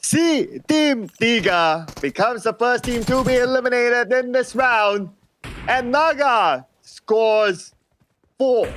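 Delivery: high hyped announcement; esports caster. Real, not acted. Co-caster hypes an elimination.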